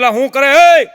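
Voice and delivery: startled shout at a friend on the rails; shocked shout; alarmed call. Retold or imitated. Alarmed call to man on tracks.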